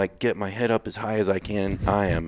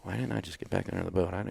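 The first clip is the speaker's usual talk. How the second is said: echoes the question fast and much softer, half to himself; quiet rushed echo; 11 dB quieter, much faster than usual. Retold or imitated. Answering people who ask why he quit, rushed quiet echo. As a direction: toss it back quickly under his breath. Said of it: "And I'm like".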